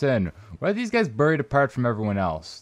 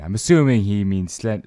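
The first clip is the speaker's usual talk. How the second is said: ordinary talk, nothing marked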